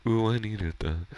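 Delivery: playful high character quote; Patrick voice. Acted. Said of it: "try to go to the Patrick voice".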